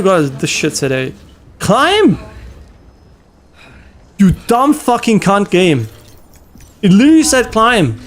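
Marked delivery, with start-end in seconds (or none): 1.6-8.0 furious shout at the game; raging gamer. Real, not acted. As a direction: yell in frustration.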